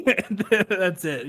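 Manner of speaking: cracking up at your own joke; laughing. Real, not acted. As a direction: barely get it out for laughing.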